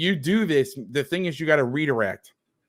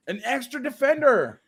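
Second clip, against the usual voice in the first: "An extra defender" echoed high and mocking; sarcastic repeat; 11 semitones higher than usual. Real, not acted. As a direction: throw the phrase back with scorn. Coach sarcastically repeats his own phrase.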